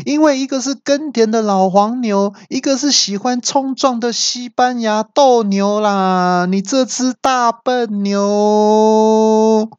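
high, drawn-out mocking shout; GPS shouting back. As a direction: have the GPS yell back and mock. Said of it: "那导航也大叫说".

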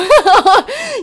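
high loud laugh bursting out; burst of laughter. Real, not acted. Laughing at own injury story. (突然大笑)

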